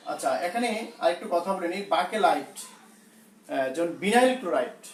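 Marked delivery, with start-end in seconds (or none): none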